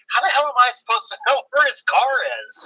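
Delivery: lightly acted "how should I know" protest; retold exasperation. Retelling exasperated reply on the phone.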